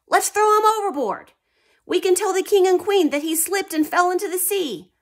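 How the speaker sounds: high, loud conspiring sailor voice; plotting sailors. Acted. Mutinous sailor plotting against the admiral, high loud voice. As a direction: scheme it out like a mutinous crewman.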